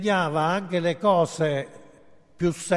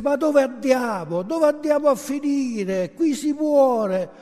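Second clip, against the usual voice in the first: frightened cry; 7 semitones higher than usual. Acted. Passenger in a dark train tunnel, crying out in fear. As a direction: cry out in alarm, high and stretched. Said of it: "cominciò a gridare, specialmente in una galleria".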